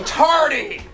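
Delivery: loud, high, falling shout; hammed-up schoolroom reprimand; scolding call-out. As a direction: call out the latecomer. Scolding latecomers, played up.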